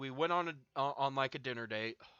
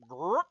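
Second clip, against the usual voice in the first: a short, croaking gut rumble; imitated stomach lurch; stomach gurgle noise; 3 semitones higher, 8 dB louder than usual. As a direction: mimic a loud stomach gurgle with the throat. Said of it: "my stomach just goes".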